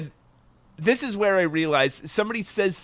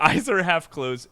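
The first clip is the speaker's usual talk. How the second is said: ordinary talk, nothing marked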